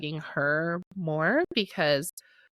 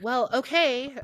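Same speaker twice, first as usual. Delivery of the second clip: mock dismissive parent; 6 semitones higher, slower than usual. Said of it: "in that exact tone of voice".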